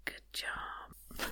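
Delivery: soft whispered praise; whispering. Real, not acted.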